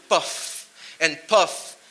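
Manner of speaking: lazy mocking smoker's drawl; slow mock puffing. Shown as a demonstration. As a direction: puff and puff drawn out very slowly, x0.3. Mimics slow smoking.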